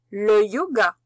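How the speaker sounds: "le yoga" called out loud and clearly to dictate it; dictation voice. Shown as a demonstration. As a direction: announce "le yoga" loudly for the class to write. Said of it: "Le premier mot".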